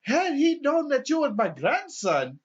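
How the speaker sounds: high, loud offended grandpa; the old man challenging the cop, high and loud; indignant old man. Retold or imitated. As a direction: sound scandalised and high. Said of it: "he was just like".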